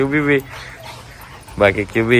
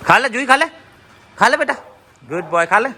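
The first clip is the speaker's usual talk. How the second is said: coaxing the dog; 11 semitones higher than usual. Real, not acted. Coaxing hurt dog to eat.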